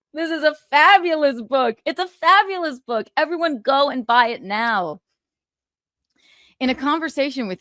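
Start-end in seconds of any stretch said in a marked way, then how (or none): 0.1-5.0 high, loud gushing sales pitch; gushing plug. Pretend. Hyping his book to viewers, high loud gushing. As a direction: sell it like a game show host.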